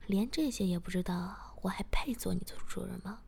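ordinary delivery

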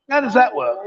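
ordinary delivery

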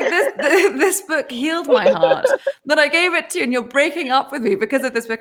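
high-pitched, laughing disbelief; incredulous outburst. Retold or imitated. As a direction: squeal it out, half laughing at the absurdity. Her outraged reply to the breakup.

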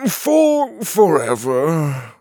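very slow, grudging sigh of defeat; reluctant giving in. Acted. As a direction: give in unwillingly. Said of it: "Bok Bok relented".

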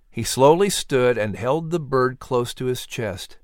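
ordinary delivery

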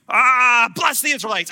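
high, loud cry forced out of him; mock anguished outburst. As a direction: blurt the blessing like it escapes you. Balaam's blessing bursts out against his will.

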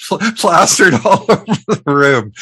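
incredulous laugh breaking up the line. Real, not acted. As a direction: crack up while telling it. Laughing as she recounts the prank.

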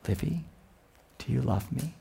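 quiet, gentle ask; soft father question. Retold or imitated. Father at bedtime asking his daughter softly. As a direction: ask it tenderly, barely above a murmur.

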